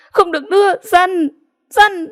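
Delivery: high, desperate cry of a beaten sister; a girl crying out to stop her little sister; helpless cry. A narrator's reading. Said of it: "Chị xỉu gào lên bất lực".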